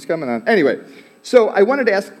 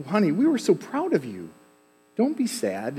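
a higher, softer touch of a tender mom; comforting mom; 6 semitones higher, 3 dB quieter than usual. Retold or imitated. Mom comforting her tearful son in the car, softly. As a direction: soothe the boy gently, a hint of mom.

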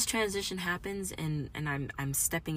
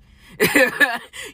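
short, high burst of laughter; loud cackle; 8 semitones higher, 14 dB louder than usual. Real, not acted. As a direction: cackle at your own analogy. Laughs during wine analogy.

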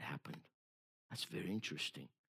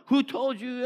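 mock doubting pastor; 13 semitones higher, 5 dB louder than usual. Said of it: "the pastor told them".

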